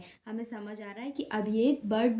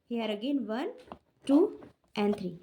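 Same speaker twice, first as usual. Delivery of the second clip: ordinary talk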